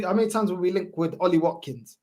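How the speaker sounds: usual speaking voice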